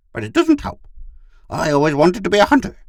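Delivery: very high, loud dog character; wistful talking dog. Acted. Gur admits his wish to Pid, high dog voice.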